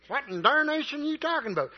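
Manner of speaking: put-on high, quick old-timer outburst; folksy exclamation. Preacher mock-quoting a folksy phrase to the congregation, high and quick. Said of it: "we can say darnation".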